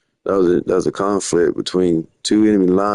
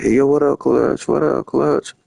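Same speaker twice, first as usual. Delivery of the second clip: friends greeting him; 8 semitones higher than usual. Retold or imitated. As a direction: imitate friends hollering a greeting. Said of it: "everyone be like".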